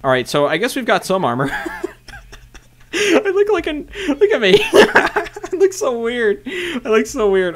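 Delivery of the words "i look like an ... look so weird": high-pitched giggly laughter through the words; laughing. Real, not acted. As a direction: laugh at how silly you look. Laughing at his own armor look.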